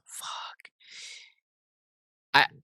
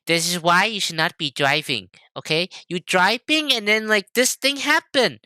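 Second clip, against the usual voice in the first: imitating mom scolding; 10 semitones higher than usual. Mimicking mom lecturing about driving, high ranting voice. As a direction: rant like an exasperated mom. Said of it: "she's like".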